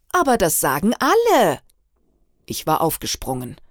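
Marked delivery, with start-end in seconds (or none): 0.1-1.6 high, loud, swooping insistence; insistent protest. A narrator's reading. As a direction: defend the gossip emphatically, pitch jumping up. Friend insists on the rumour. (nachdrücklich beharrend, hoch und laut)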